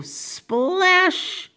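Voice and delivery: high-pitched, drawn-out splash; splash exclamation. Acted. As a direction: stretch it high like a dive. Imitates Mister Carp's dive.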